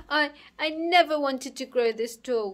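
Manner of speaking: loud, high tearful wail; weeping. Acted. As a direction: wail it out through mock tears. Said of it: "wept Alvis".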